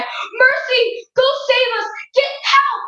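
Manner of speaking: high, urgent plea; pleading parents. Acted. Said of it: "They both looked at Mercy and said".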